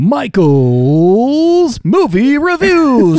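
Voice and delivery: high, slow, grand announcer; game-show announcer; segment announcer. Acted. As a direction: present the segment title like a TV show.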